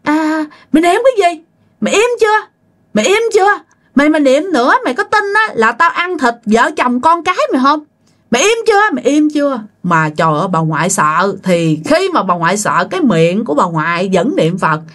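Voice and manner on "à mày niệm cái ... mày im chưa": high, scolding spirit voice; cursing ghost. Retold or imitated. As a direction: threaten the family like an angry ghost. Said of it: "bà ngoại mới nghe chửi". (giọng ma chửi, cao và dữ)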